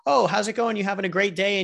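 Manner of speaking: cheery salesman. Acted. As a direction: play the eager salesman making small talk. Salesman at the door greets Dave.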